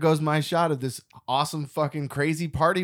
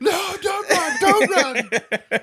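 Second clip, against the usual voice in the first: high panicked call, half laughing; frantic shout; 5 dB louder, much slower than usual. Retold or imitated. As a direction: beg her not to run. Quoting man calling after her.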